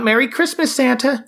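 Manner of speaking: high-pitched imitation of kids calling; children calling out. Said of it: "giggling children watching from a nearby building, calling out".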